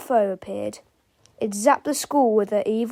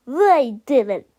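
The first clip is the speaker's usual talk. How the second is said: accusing; 2 semitones higher, 7 dB louder than usual. A narrator's reading. Melvin accuses the boys.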